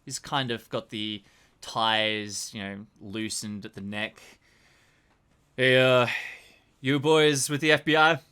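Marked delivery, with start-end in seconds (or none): 5.6-8.2 loud detective sizing up strangers; NYPD detective. Acted. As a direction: call out to them as a detective. Game master voices police detective.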